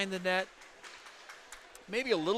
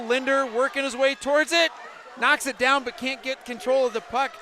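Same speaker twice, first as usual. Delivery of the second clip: louder, higher rush of commentary; excited play-by-play; 5 semitones higher, 8 dB louder than usual. Real, not acted. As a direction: call the play as tension builds. Live play-by-play rising.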